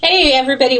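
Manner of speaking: normal talking voice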